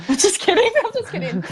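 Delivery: real giggle through the words, high-pitched; laughing. Real, not acted. Guest taking back her Tinder profile joke to the host, laughing.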